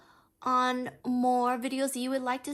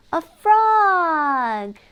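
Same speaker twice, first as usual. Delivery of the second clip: louder, brighter announcement; excited reveal; 5 semitones higher, 10 dB louder than usual. Acted. Reader showing the frog to the child, excited. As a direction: present it with delight.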